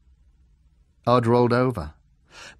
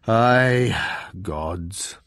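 solemn bear; 5 dB louder than usual. A narrator's reading. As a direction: confirm it with ponderous grandeur. Bear confirms grandly.

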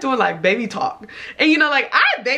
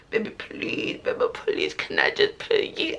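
mocking baby talk, softly croaked without full voice; 6 dB quieter than usual. Teller mimicking her date's begging, soft baby voice. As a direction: imitate a pleading man talking like a baby. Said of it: "Talking in his baby voice".

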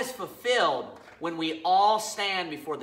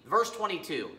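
normal talking voice

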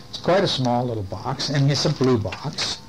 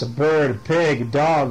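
ordinary delivery

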